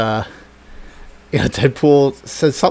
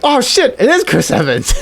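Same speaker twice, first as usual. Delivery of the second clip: excited realization; 12 semitones higher than usual. Retold or imitated. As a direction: burst out as it clicks. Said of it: "And you're like".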